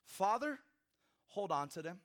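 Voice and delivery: high, loud, impassioned sermon voice building to the prayer; fervent preaching. Acted. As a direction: preach it at full force, pleading with the Father. Preacher voices Jesus' prayer again.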